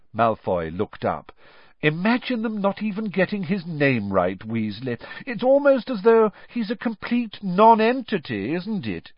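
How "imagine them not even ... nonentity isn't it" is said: a bully jeering in front of everyone; crowing. A narrator's reading. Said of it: "he crowed".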